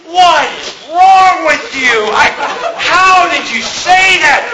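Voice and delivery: high, slow, laughing disbelief; shocked friend. Retold or imitated. Friend reacting to the joke, shocked and laughing. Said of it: "my friend was".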